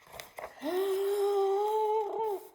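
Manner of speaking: loud, high, long theatrical gasp; hammed-up storyteller gasp; big gasp. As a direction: gasp hard as if shocked. Dramatic storytelling.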